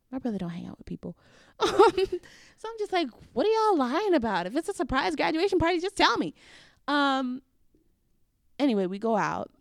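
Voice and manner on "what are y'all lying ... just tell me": higher, louder, accusing the family playfully; mock exasperated. Calling out mom and brother's cover story, loud and teasing. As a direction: call out their fib, half laughing.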